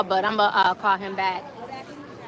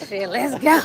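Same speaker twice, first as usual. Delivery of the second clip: usual speaking voice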